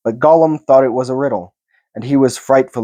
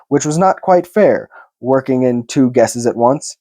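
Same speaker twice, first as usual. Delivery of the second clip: plain reading voice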